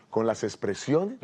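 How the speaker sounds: ordinary delivery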